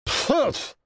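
a high scornful pff; huffy snort. Acted. King snorts at losing, high and huffy. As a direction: snort in royal displeasure. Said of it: "отфыркивался он".

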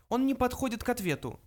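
high little mouse, dismissive. A narrator's reading. Mouse answers Arthur's question, high voice. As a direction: wave the idea away in a high voice. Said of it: "ответил Фрэнки".

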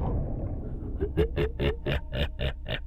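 hissing, whispered evil cackle; shrill ghostly cackle. Acted. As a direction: cackle like a spirit. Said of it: "cười lớn".

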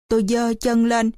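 usual speaking voice

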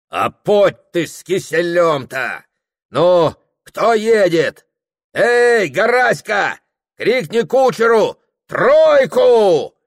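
loud, high, building to a yell; rowdy shouting. Acted. Host ordering a troika from the servant, shouting. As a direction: brush it off, then holler for the servant.

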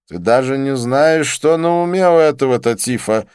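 high, loud reproach; reproachful. A narrator's reading. Didier reproaches the narrator over Tatif, high and reproachful. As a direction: scold a friend for being too trusting. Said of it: "с укоризной".

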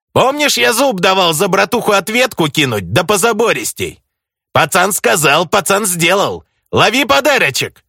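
high, taunting gangster swagger; mocking thug voice. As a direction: gloat like a street thug. (глумливый бандитский голос)

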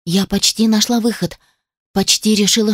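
usual speaking voice

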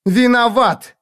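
high, furious cry; shrieked verdict. A narrator's reading. Girl cutting across the man's plea, shrieked accusation. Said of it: "выкрикнула пацанесса".

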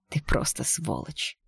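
soft, slow and scornful; quiet contempt. Acted. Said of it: "тихо сказала я".

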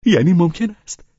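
high, loud, delighted question; joyful cry. A narrator's reading. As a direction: cry out in open joy, rising at the end. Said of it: "شادیش را مخفی نکرد و فریاد کشید".

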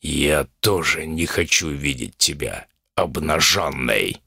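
deep, slow threatening growl; menacing bull demon. Acted. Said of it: "заявил Умаван".

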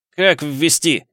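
angry shout. A narrator's reading. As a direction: snap it out in frustration. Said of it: "выкрикнув".

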